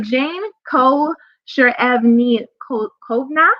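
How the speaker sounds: slow, careful name, piece by piece; said slowly. Real, not acted. Host introducing a musician so everyone gets the name, slowly. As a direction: spell it out slowly by sound. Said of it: "I'm gonna say this slowly".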